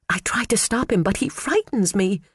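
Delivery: high nervous squeak; timid secretary squeaking to her boss. A narrator's reading. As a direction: squeak it like a frightened mouse. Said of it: "Phoebe squeaked".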